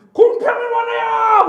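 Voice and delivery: very high, loud, drawn-out yell; defiant shout. Retold or imitated. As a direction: holler the demand back, acting it out. Acts out yelling back at God. (목청껏 길게 외치는 항변)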